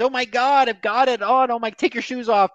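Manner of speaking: high, hammed-up panic, as if contaminated; mock panic. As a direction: shriek in mock horror about catching it. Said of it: "It was like".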